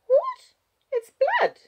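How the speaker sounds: put-on very high, loud little girl; child's voice. A narrator's reading. Child puzzled by Wallace's warning, high child voice.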